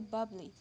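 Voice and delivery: bubbly said as two syllables, BUB-lee; syllable dropped. Shown as a demonstration. Teacher showing the lost syllable, two-syllable bubbly. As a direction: say bubbly with no vowel between b and l. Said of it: "a syllable is lost when Y is added".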